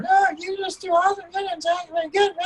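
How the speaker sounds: very high helium squeak; squeaky little voice. Acted. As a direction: mimic a shrunken bully, tiny and squeaky. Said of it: "squeaky little voice".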